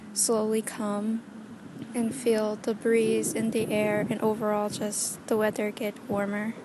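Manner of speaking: ordinary delivery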